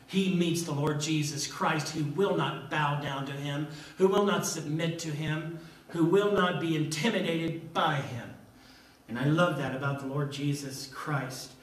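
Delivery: ordinary talk, nothing marked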